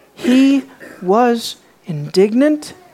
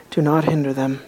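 much softer and lower, upset held in; quiet upset; 8 semitones lower, 6 dB quieter than usual. Acted. Friend who speaks quietly when upset, soft and low. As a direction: say it low, the quiet kind of angry. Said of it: "his voice is not elevated".

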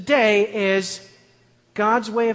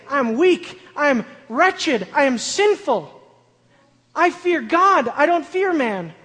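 high, loud, impassioned preacher; preaching at full force; 5 semitones higher, 7 dB louder than usual. Acted. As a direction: cry it out to the congregation.

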